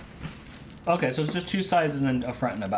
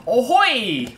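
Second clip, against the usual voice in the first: pirate ahoy; 8 semitones higher, 8 dB louder than usual. Pretend. Playing pirate sailor.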